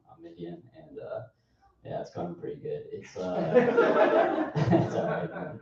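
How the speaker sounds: ordinary talk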